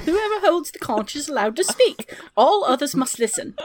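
prim, high British schoolboy voice; British schoolboy accent. Pretend. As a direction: play a pompous English schoolboy claiming the floor. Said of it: "speak in this accent".

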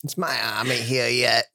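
lightly put-on mimicry of another player; mocking imitation. Teasing a friend about the army, lightly mimicking. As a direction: tease with a slight mock voice. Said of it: "That's not how I sound".

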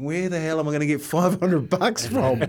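laughing through the worried thought; laughing panic. Real, not acted. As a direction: chuckle at your own panic. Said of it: "And I'm thinking".

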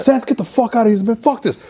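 high-pitched retold outburst; agitated outburst. Retelling the fight before the door got punched, agitated.

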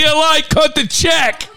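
loud slow high shout. Pretend. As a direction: bellow it out slowly, high. Joking shout at the crew.

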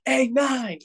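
high-pitched mock holler; taunting call. Field guy hollering at the player by number, taunting. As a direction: call out to him across the field.

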